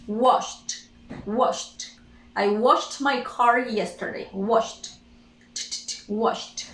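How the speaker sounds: "Washed" ending SH then a released T; final T sound. Shown as a demonstration. Teacher drilling the simple past of wash, final T sound. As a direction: say "washed" with no E, just T. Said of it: "only T".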